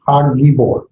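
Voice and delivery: Hagibor with the g held long; doubled g. Shown as a demonstration. Teacher showing the doubled g to students. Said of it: "that G sound twice".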